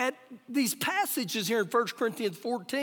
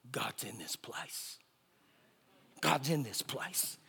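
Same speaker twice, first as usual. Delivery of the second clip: very low, near-whispered, slow quote; hushed awe; 11 semitones lower, 11 dB quieter than usual. Retold or imitated. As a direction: imitate an awestruck man, barely voiced. Retells awed young man's words.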